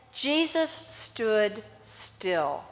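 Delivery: very slow, hushed and still. Shown as a demonstration. Preacher slowing down for the still moment, hushed. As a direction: let each word hang in stillness. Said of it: "is interesting".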